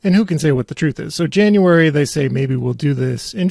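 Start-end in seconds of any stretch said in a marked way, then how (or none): none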